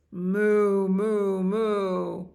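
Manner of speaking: loud, long, connected moos; cow mooing. Acted. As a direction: moo like a herd.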